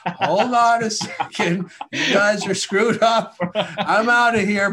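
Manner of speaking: high, loud, laughing alien voice; hammed-up alien backing off. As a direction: back away slowly in a mock alien panic. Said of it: "the aliens are like".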